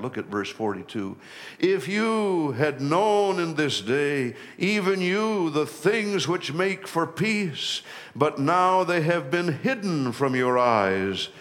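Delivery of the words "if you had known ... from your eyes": higher, lifted voice reading Jesus' lament; preacher reading scripture aloud. Acted. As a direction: proclaim the verse like Jesus grieving.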